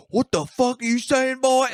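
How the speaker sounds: hammed-up outrage; angry militia guy. Militia guy snapping at the comic onstage, high mock anger. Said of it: "the guy goes".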